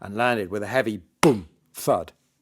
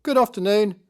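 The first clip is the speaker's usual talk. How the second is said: put-on high, loud children's reply; chorus it back like a party of kids; 12 semitones higher, 6 dB louder than usual. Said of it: "a few of the kids chorused".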